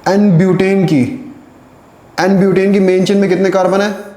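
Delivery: emphatic question to class. Real, not acted. Teacher asks class emphatically.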